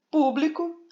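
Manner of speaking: "público" agudo, com PÚ destacado; ênfase na antepenúltima sílaba. Shown as a demonstration. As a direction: bata no PÚ inicial de "público". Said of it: "a sílaba tônica é a antepenúltima". ("público" com peso na primeira sílaba)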